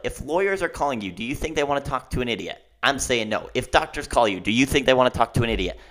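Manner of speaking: ordinary talk, nothing marked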